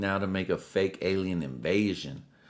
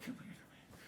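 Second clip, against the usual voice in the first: whispered call to cat; 22 dB quieter than usual. Retold or imitated. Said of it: "I'm like".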